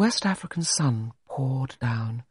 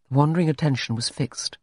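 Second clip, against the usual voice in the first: plain reading voice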